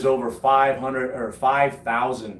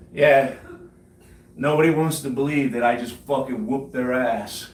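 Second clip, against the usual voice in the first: ordinary talk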